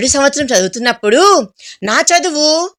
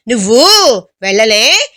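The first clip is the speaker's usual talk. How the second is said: confused; 2 semitones lower than usual. A narrator's reading. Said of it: "అని అడిగాను తికమక పడుతూ".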